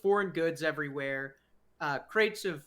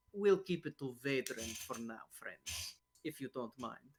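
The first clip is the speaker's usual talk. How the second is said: hushed; 5 semitones lower, 7 dB quieter than usual. Acted. Elbowed man cuts off introduction.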